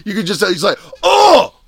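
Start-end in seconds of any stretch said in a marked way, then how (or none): 1.0-1.5 much higher, louder startled "Oh!"; hammed-up shocked cry; caught-out yelp; mock yelp. Coach caught out.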